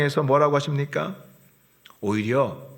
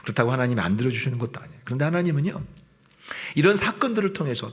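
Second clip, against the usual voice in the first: normal talking voice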